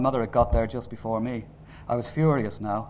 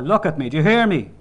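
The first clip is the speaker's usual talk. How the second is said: loud, raised, insistent challenge; angry husband pressing his point; angry demand; 5 semitones higher, 9 dB louder than usual. A narrator's reading. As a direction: demand to be heard, voice climbing. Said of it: "My father was angry and not interested in stopping".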